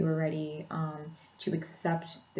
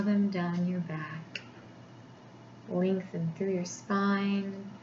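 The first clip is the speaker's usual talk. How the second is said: guided meditation; 3 semitones higher than usual. Acted. Therapist guiding listeners into a body scan, meditation voice.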